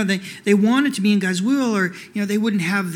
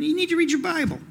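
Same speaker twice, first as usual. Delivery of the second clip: urging; 8 semitones higher than usual. Acted. Pastor urging the congregation.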